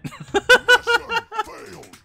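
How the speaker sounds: squealing burst of laughter; high laugh. Real, not acted. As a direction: crack up at the pit death. Laughs at dying in pit.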